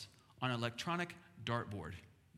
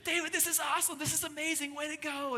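put-on excited kids cheering; 13 semitones higher than usual. Siblings cheering David's gift, high and excited. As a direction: cheer him on like a thrilled big sister. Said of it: "They were so excited".

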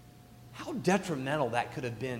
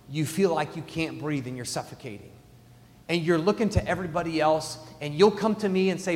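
usual speaking voice

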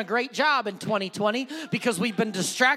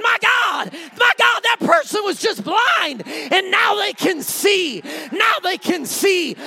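amazed shout; 5 semitones higher than usual. Pretend. Bystander jumping at the miracle, crying out amazed. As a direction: shout it like you just saw a blind man see.

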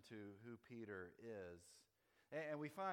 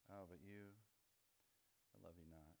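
mock regret, low and hushed; petal-plucking rejection; 5 semitones lower, 8 dB quieter than usual. God pulling flower petals over a person, mock rejecting. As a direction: let the pretend verdict sink low and soft. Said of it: "for each person, God has a flower".

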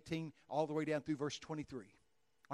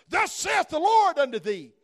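booming, slow, much higher and louder; mock King James prophet; 12 semitones higher, 19 dB louder, slower than usual. Preacher mocking others, in a thundering prophet voice. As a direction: ham up the old-time thundering prophet.

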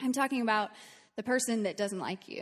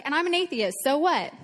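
put-on defiant kid; 5 semitones higher, 7 dB louder than usual. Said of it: "he's like".

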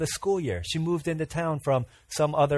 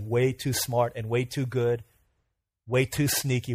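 ordinary delivery

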